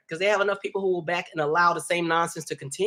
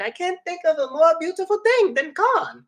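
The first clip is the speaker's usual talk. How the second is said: high-pitched imitation of a delighted child; little boy; 4 dB louder than usual. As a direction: mimic a small kid tickled about corn.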